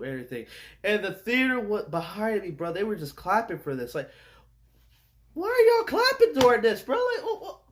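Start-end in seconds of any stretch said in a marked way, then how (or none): 5.3-7.6 high, loud mock squeal at the crowd; mock cheering fan. Mocking the theater crowd clapping, in a high mock voice.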